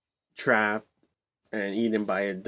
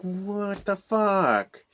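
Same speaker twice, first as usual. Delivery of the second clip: very slow, much higher, put-on what the fuck; mock outrage at the off-screen death; stunned disbelief; 9 semitones higher, much slower than usual. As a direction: drag every word out in shock. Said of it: "And I'm like".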